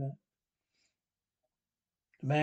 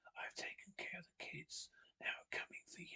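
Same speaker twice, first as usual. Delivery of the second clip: menacing trembling whisper; quivering whisper; 2 semitones higher, 17 dB quieter than usual. Acted. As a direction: breathe it out, shaking and sinister. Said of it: "With a quivering voice, he whispered".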